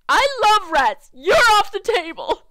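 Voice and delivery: loud, high acted yell; acted outburst. Arguing over rats and a house, loud high outburst.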